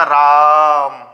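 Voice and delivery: राम with a long, loud held "aa"; drawn-out call. Shown as a demonstration. Teacher showing how one calls upon God, drawn-out call. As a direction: call out राम, stretching the vowel. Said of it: "किसी को पुकारने के लिए या खींच कर".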